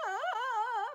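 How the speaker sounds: high drawn-out mock wail; hammed-up crying "aah"; mock screaming cry. Babysitter crying to dad on the phone, mock wail. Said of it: "I'm screaming, crying".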